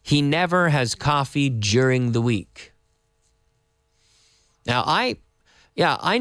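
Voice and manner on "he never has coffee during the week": slow and clear, each word separate; slow model sentence. Shown as a demonstration. Teacher reading the sentence of the day slowly. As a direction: read it slowly for learners. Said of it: "our beginner sentence today".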